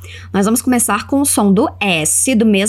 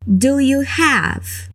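very slow model; 2 semitones higher, much slower than usual. Shown as a demonstration. Teacher modelling a question for students, very slow. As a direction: Do you have, each word drawn out very slowly. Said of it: "Agora pergunta pra mim".

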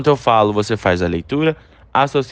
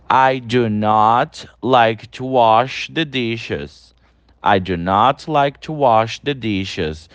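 slow, clear teacher read of an English example; slow model sentence; much slower than usual. Shown as a demonstration. Teacher reading the example sentence to students, slow and clear. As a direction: read it slowly so students can repeat. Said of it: "Olha aí as frases de exemplo".

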